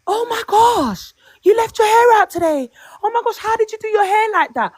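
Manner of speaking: high-pitched mock amazement; gushing co-worker imitation. Co-worker marvelling at her hair, mock gushing. Acting out nosy co-workers' questions.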